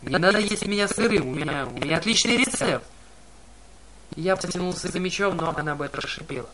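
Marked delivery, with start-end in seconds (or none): 0.0-2.9 high, loud, frantic plea; panicked pleading. Acted. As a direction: beg fast in panic. Grover begging for his life.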